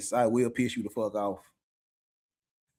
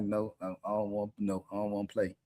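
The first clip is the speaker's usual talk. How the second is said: put-on softer, childish refusal; sulky mock refusal; 5 semitones lower, 6 dB quieter than usual. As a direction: pout it out quietly like a sulking kid. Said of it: "and I'm like".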